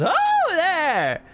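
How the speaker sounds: high, bright-voiced jolly tinkerer calling out; jolly tinkerer greeting. Tinkerer welcoming travelers at the crossroads, high and jolly. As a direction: hail the travelers like a cheery merchant. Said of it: "he looks up and goes".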